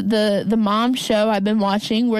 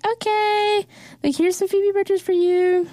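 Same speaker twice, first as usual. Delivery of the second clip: much higher, put-on voice for the film's last line; mock movie voice; 10 semitones higher than usual. Retelling the film's ending to a friend, mock high voice. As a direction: lightly send up the movie's soundtrack moment.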